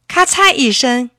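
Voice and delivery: ordinary delivery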